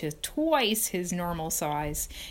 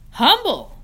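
amazed; 10 semitones higher, 11 dB louder than usual. Acted. Reading the new web word.

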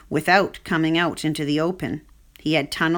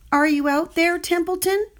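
calling out; 10 semitones higher than usual. Acted. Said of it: "called Wilbur".

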